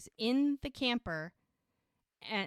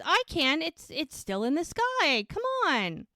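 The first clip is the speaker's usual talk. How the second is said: higher-pitched insistent protest; imitated bickering reply; acted insistence; 8 semitones higher, 4 dB louder than usual. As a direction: argue back in an exasperated voice. Acted insistent argument in story.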